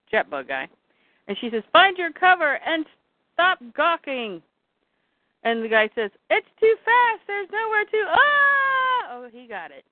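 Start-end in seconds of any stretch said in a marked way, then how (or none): 1.7-4.4 higher-pitched urgent command; urgent order. Acted. Granny ordering others to cover, urgent command. Acted order during monster attack. 6.3-9.1 high panicked cry into a scream; panic, climbing to a cut-off yell. Acted. Guy trapped by fire-breathing monster, high panic. Guy about to be burned alive.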